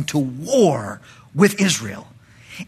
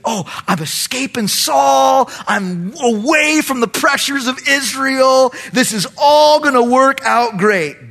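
high, louder mock-elated gloat; 8 semitones higher, 4 dB louder than usual. Preacher voicing David escaping Saul, gloating. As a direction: crow with relief, drawn out.

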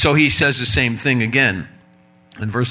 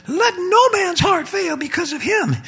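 put-on much higher boyish voice; teenage boy voice. Shown as a demonstration. David the teen boy answering King Saul, high and boyish. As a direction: voice a fifteen-year-old David. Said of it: "That wouldn't have been his voice".

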